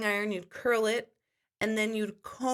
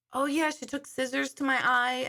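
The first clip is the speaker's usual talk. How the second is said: ordinary delivery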